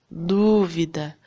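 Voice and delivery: Dúvida slowed, DÚ lengthened and emphasized; stress on first syllable. Shown as a demonstration. Teacher repeating for pupils to find the tonic syllable, stress on DÚ in Dúvida. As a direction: press on the opening DÚ of Dúvida. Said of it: "Qual é a sílaba que a gente fala com mais força".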